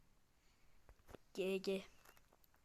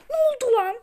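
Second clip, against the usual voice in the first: outraged; 10 semitones higher, 6 dB louder than usual. Real, not acted. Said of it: "Çok sinirlendim".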